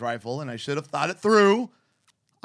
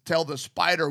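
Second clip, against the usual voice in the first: usual speaking voice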